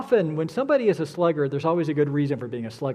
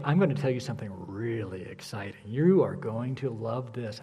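low, soft, confidential build-up; gossip intonation; 7 semitones lower, 6 dB quieter than usual. Shown as a demonstration. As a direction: tease a juicy secret under your voice. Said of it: "There's always this intonation like".

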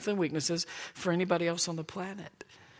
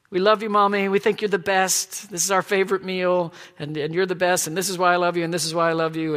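loud, higher, hammed-up family tribute; exaggerated gushing praise; 4 semitones higher, 7 dB louder than usual. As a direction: pile on the praise at top volume. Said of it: "that was the exaggeration of rising up to bless her".

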